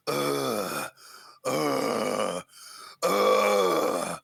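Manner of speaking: rising vocal fry into a scream; slowly tighten the throat into a grit; gravelly scream. Shown as a demonstration. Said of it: "more gravelly".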